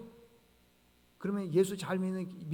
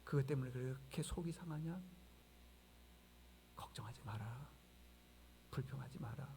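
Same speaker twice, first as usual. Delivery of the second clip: soft voice of God; 3 semitones lower, 10 dB quieter than usual. Acted. Preacher voicing God's soft comfort to Jeremiah. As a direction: speak softly as God comforting the grumbler. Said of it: "하나님이 뭐라 그러신 줄 아세요".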